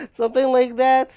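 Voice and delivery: loud, high laugh through the words; cracking up at his own drawing. Real, not acted.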